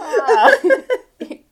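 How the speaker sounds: high loud witch cackle. Pretend. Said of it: "I was being like Hansel and Gretel".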